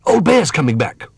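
high-pitched urgent warning; calling out. A narrator's reading. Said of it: "he called".

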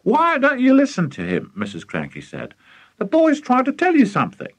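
high-pitched exasperated reproach; sharp, high motherly rebuke; scolding wife. Acted. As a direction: take him to task in a high voice. Mother scolds excited father.

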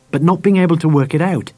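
usual speaking voice

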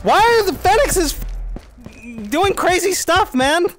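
high, loud panicked rambling; panicking gamer. Real, not acted. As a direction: freak out at the chat. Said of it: "I can stop panicking as much".

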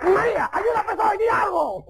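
hammed-up pleading yell; panicked call. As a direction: call out helplessly to the girlfriend. Suitor calls girlfriend for help.

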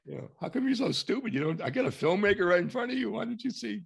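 high, fast, hammed-up disbelief; mock exasperation. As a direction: play up your outrage, teasing, quick and high. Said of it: "When I said it without offense, I just said".

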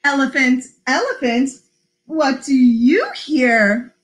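slow, lilting story reading; picture-book read-aloud. Acted. Librarian reading Polar Bear to children, slow lilting read-aloud. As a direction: read it slowly with singsong emphasis.

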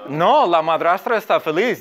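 usual speaking voice

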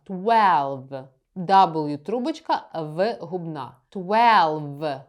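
ordinary delivery

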